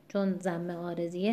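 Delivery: normal talking voice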